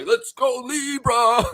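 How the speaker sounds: high, excited cheer; rally the crowd. Real, not acted. Cheering for Libra.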